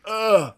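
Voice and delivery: short, loud groan of discomfort; pained "ugh". Acted. Host mimicking Han's frozen grimace, pained groan. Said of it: "face going".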